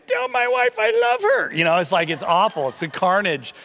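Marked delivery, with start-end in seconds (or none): 0.1-1.5 high, strained last-words voice; hammed-up dying plea, slow and high-pitched; dying cookie. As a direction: squeak out mock-tragic final words. Voices a gutted, dying Oreo.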